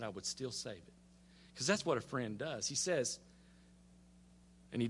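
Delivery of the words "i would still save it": low, very soft assurance; reply quietly and low; patient Lord answering. Retold or imitated. The Lord answering Abraham's final plea, quiet and steady. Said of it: "And he said".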